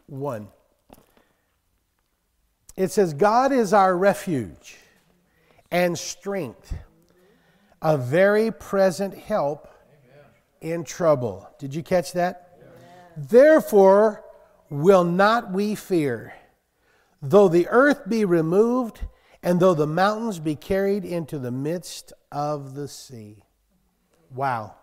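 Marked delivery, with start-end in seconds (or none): 3.1-11.5 slow, loud, drawn-out proclamation; preaching scripture. Acted. Preacher reading Psalm forty-six to the congregation, slow and booming. 13.2-23.4 much louder, very slow proclamation; booming scripture. Acted. Preacher thundering the psalm's promise to the church, slow and loud.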